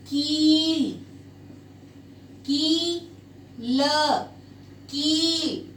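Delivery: कील said slowly three times with a long ई; teacher models the long ई in कील; repeated demo of कील. Shown as a demonstration. Teacher showing students how to say कील, drawn out. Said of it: "इसको pronounce किया जाता है".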